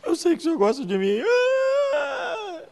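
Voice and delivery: high, tearful voice; girl crying to her pastor. Retold or imitated. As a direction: sob it out like a hurt teenage girl. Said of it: "Alessandra chora".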